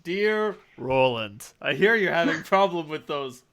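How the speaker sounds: playful fake spam letter; mock sales letter voice. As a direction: read it like junk mail.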